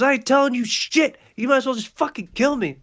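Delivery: high, loud desperate shout; defiant yell. Acted. Dangled thug shouts at Pee-wee to just kill him. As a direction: yell it, daring them to drop you.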